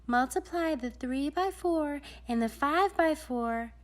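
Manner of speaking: a lightly voiced lady's lesson; Madame de Géométrique. A narrator's reading.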